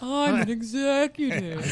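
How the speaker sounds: mock ghostly voice. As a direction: mimic a co-host as a drawn-out ghost. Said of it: "why do I sound like a ghost".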